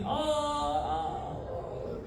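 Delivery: high wail dying away softly; mock pained cry. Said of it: "started screaming in pain".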